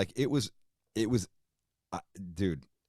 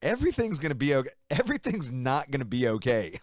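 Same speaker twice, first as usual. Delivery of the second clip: higher, mocking echo then denial; incredulous retort; 7 semitones higher than usual. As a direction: throw his words back at him.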